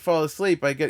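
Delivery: ordinary delivery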